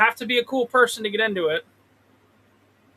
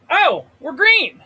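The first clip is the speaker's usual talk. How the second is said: higher, louder, slow delighted cry; gleeful, stretching green out; drawn-out happy exclaim; excited; 7 semitones higher, 6 dB louder, slower than usual. Real, not acted. Stock turns green.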